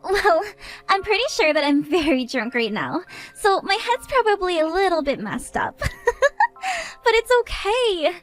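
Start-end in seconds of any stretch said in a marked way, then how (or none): 5.9-7.0 shrill giggling cackle, much higher; high drunken cackle. Acted. High tipsy cackle. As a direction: drunk guest cackling at the bride. Drunk guest mocks the bride.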